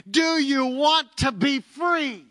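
high, loud, slow preacher's appeal; impassioned appeal. Retold or imitated. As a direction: stretch each word into a ringing plea.

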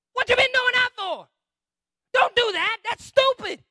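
high, loud outburst; hammed-up pastor telling someone off; exasperated scolding. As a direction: yell it in disbelief. Pastor's acted scolding reply.

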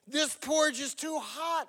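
high-pitched storybook exclamation; exclaiming. A narrator's reading. Goldilocks tasting the first bowl, exclaiming. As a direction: exclaim it as little Goldilocks would. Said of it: "she exclaimed".

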